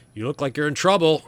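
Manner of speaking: a hint of a roadside stranger; horse rider. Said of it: "the guy on the horse says".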